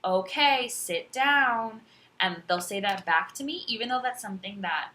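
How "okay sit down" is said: high, loud singsong teacher cadence; students copying teacher. Retold or imitated. Younger students modelling teacher's speech, high singsong teacher voice. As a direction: mimic kids echoing a classroom instruction. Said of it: "some of my younger students".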